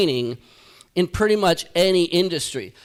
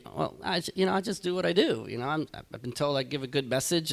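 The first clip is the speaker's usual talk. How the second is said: much softer, hesitant; sheepish retold reply; 4 semitones lower, 11 dB quieter than usual. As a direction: quietly stumble through a mock-humble answer. Retells his timid reply to pastor.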